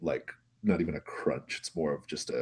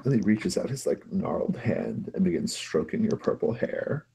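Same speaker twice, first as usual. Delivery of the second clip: ordinary delivery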